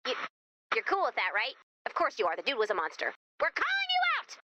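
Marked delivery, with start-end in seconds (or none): 3.4-4.4 mock showdown call. Cowboy challenge to the outlaw.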